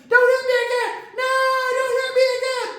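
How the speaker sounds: very high sing-song crocodile taunt; high cartoon croc mocking the plea; mocking puppet. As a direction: mimic him in a squeaky singsong. Puppet mocks the host.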